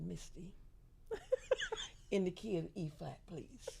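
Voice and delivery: prim, proper and soft; sweet, proper request; prim little voice. Retold or imitated. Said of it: "I didn't talk like this at all".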